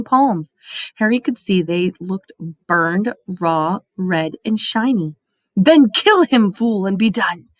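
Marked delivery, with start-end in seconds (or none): 5.5-7.5 screeched. A narrator's reading. Voldemort screeching at Quirrell to kill Harry. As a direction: spit the command out. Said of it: "screeched Voldemort".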